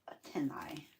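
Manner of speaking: "antennae" whispered, no voice; whispered word. Real, not acted. Gluing antennae on the butterfly, saying "antennae" in a whisper. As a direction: breathe "antennae" out unvoiced.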